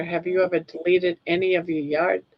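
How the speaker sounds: ordinary talk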